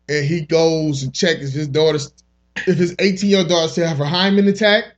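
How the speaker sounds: ordinary delivery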